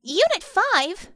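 high, loud, slow outraged call; shouted. A narrator's reading. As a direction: shout the name in alarm. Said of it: "Tammy shouted".

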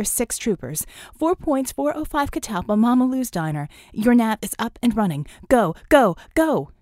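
loud, high, urgent callout over the radio; rally the team, all hands, now; urgent alarm call. Acted. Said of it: "Vicky broke in".

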